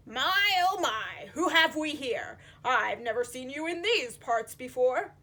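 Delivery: put-on nosy surprised old fish; fussy character voice; puffer fish voice.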